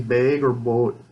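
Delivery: BAG and BOAT with long, drawn-out vowels; elongated Minnesotan vowels. Shown as a demonstration. Speaker showing how a Minnesotan accent comes through, elongated vowels. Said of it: "I start to elongate my vowels".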